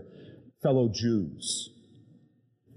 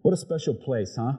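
normal talking voice

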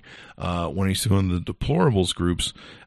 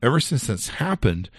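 normal talking voice

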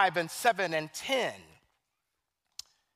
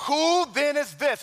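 awed question; 8 semitones higher, 4 dB louder than usual. Acted. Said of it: "they said to one another".

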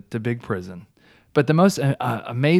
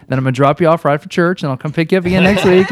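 loud, high, playful mock courting; breezy suitor; 3 semitones higher, 9 dB louder than usual. Man arranging Sunday dates with God, breezy suitor. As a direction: pitch a casual date, loud and chirpy. Acts out casually dating God.

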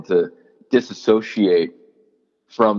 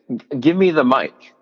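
passionate demand; 6 semitones higher, 3 dB louder than usual. Retold or imitated. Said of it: "like, passionately".

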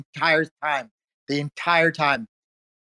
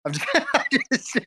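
laughing through the words, voice up high; can't get the words out for laughing; 8 semitones higher than usual. Real, not acted. Host cracking up over the C word joke, laughing.

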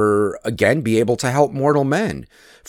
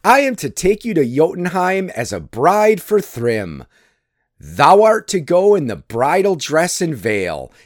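lightly gleeful telling; amused, higher voice; 7 semitones higher than usual. A narrator's reading. Loki telling Thor he will be bride, amused. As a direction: share the scheme with suppressed glee. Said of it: "said laughing Loki".

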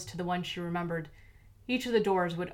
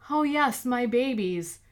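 doting delight; 5 semitones higher, 4 dB louder, slower than usual. A narrator's reading. Villain doting on beetles.

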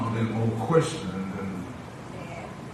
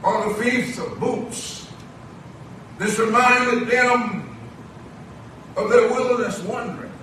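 projected sermon cadence in short, held phrases; preaching; 11 semitones higher, 7 dB louder, slower than usual. Acted. Preacher assures the church that the Lord always provides, preaching. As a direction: stretch key words, then pause for the congregation.

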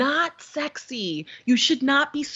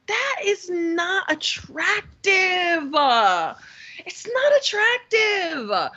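high, drawn-out exasperated whine; exasperated rebuke; 7 semitones higher, much slower than usual. Retold or imitated. Woman tells off clumsy suitor, drawn out and exasperated. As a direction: stretch every word in mock exasperation.

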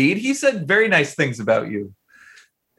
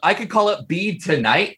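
ordinary talk, nothing marked

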